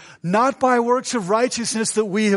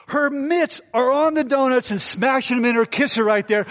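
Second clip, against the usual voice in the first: ordinary talk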